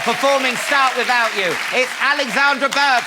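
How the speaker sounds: normal talking voice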